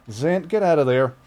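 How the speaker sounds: high, loud sharp shouts; shooing yell. Real, not acted. Owner yelling to shoo his cat out, loud sharp shouts. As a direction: chase the cat off.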